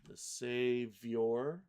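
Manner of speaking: very slow, split word; drawn-out lyric dictation; syllable-by-syllable reading. Shown as a demonstration. Reads lyrics while pasting syllables.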